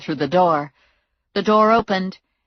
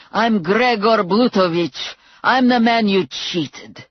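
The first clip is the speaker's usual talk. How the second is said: higher, slow, menacing; angry thug; 4 semitones higher, slower than usual. A narrator's reading. Blutovich confronting the man who cheated him, slow and menacing. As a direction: spell out each word like a threat. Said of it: "he yelled at Muner".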